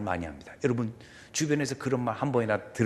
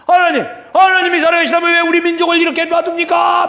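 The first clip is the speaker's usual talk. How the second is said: high, shouted complaint to God; acted scolding; 13 dB louder than usual. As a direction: yell it up at God in accusation. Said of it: "막 그러고 야단쳤어요".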